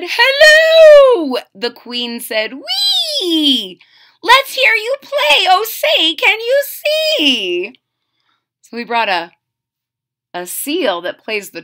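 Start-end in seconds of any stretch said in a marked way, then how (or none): 0.0-1.4 very high, loud call; king's big hello. Acted. The king cries hello to the boy. 2.5-7.8 high, loud, delighted; excited queen. Acted. The queen asks the seal to play, delighted.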